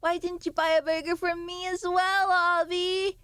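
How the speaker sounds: high, slow, crying mock whine; whiny chat. Streamer mocks hungry chat, whiny and tearful. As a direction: pretend to sob over a burger. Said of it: "you'll be like".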